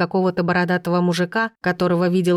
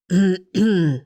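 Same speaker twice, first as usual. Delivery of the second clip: throat clearing. A narrator's reading. Bearded stranger clears his throat to announce himself. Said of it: "подал голос незнакомец".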